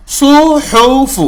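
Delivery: slow سو حوف with heavy sad and throaty h. Shown as a demonstration. Teacher models a heavy letter for students. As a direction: draw out سو حوف, sad full and thick. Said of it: "صاد ہم نے زبر میں موٹا پڑھا".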